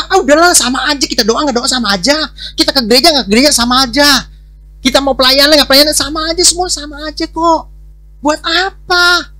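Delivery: loud, high-pitched mock indifference; dismissive excuse. Believer arguing prayer makes no difference, loudly dismissive.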